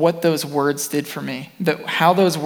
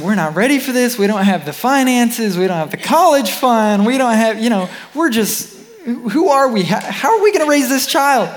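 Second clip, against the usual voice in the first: higher and louder, building anxious rush; mock worried pleading; 8 semitones higher, 4 dB louder than usual. Husband voicing their fears to God, rising anxious pleading. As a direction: pile up the worries until they spill over. Retelling couple's fearful objections.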